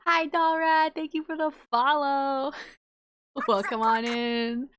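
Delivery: high, loud, stretched-out thanks; excited follower welcome. Real, not acted. Greeting a new follower on stream.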